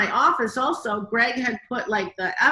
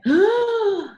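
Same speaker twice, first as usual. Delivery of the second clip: a loud, high, sudden gasp of shock; a real loud gasp of disbelief; sharp gasp; 12 semitones higher, 6 dB louder than usual. Real, not acted. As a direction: gasp as if the news just hit. Hearing about the big episode.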